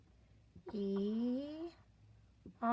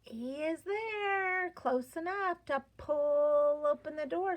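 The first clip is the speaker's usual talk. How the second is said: dramatic reveal; 5 semitones higher, 5 dB louder than usual. Acted.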